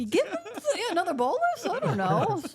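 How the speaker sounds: high, loud questions through laughter; hammed-up helpless guessing; clueless guessing. Junior doctors guessing at treatment, laughingly clueless.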